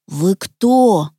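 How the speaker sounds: ordinary delivery, nothing marked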